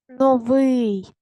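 Новий with a long, loud second syllable; stress on ВИЙ. Shown as a demonstration. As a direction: stretch and punch the ending of Новий. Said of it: "Який наголос". (Новий з сильним протяжним наголосом на ВИЙ)

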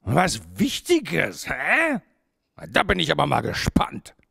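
sceptical porcupine. A narrator's reading. Porcupine doubts the owl's important business, sceptical. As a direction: challenge them, stretching the first words and pitching up on the "hä".

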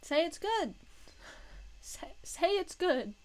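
hammed-up insistence; playful demand. Playfully demanding approval of impression.

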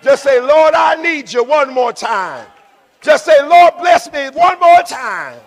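high, loud exhortation; rousing preacher's call. Real, not acted. Preacher has congregation repeat a prayer, rousing call. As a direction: call out for the congregation to echo you.

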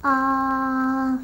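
long, steady drawn-out "Ah"; really slow. Shown as a demonstration. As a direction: stretch out "Ah" to feel the sound. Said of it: "it really slowly while you feel it".

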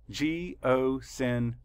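slowed right down, each syllable split apart. Shown as a demonstration. Teacher counting syllables aloud for students, slow and broken up. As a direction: tap out every syllable slowly. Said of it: "Count the syllables".

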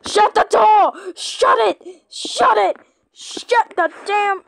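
high, loud furious yell; angry shout. Real, not acted. Frustrated player shouting at teammates to shut the door. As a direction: bark it at the team. Yelling at team in game.

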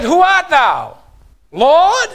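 high, loud question rising at the end; calling question. Acted. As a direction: call it upward, lifting sharply on the last word. Saul asks the unknown voice.

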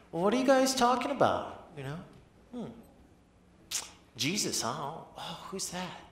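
high-pitched feigned cluelessness; playing dumb. Jesus messing with the disciples, playing dumb. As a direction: act innocently puzzled, teasing them. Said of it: "he acts like he's, you know, stupid".

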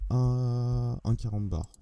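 "quarante bar" said slowly as verlan slang. Shown as a demonstration. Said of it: "Je vais essayer de parler en verlan".

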